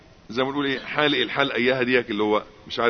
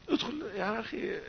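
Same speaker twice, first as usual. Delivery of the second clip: tempting devil; 9 semitones higher, 10 dB quieter than usual. Retold or imitated.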